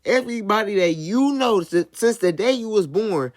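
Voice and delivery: high, hammed-up announcer voice; mock a cheesy show commentator; mock corny commentator. Mocking DJ Akademiks narrating the tragedy, high and corny. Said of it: "he's doing this corny ass voice over it".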